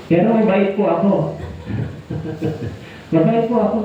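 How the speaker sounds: laughing it off while insisting; chuckling through the claim. Real, not acted. (natatawang pagsasabi)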